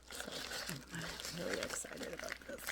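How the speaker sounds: whisper. Real, not acted. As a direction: whisper the excitement. Whispers excitement.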